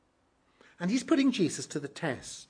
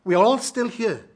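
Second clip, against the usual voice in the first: loud urgent call across the prison; 5 semitones higher, 8 dB louder than usual. Retold or imitated. Paul calls out to the jailer about to kill himself, loud urgent call. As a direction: shout it to stop him in time. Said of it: "And Paul calls out to him".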